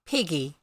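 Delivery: Peggy with the Kiwi E sounding like i. Shown as a demonstration. Reader showing the chat the Kiwi vowel in Peggy. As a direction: say Peggy the New Zealand way. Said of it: "the way the New Zealanders say the E sound".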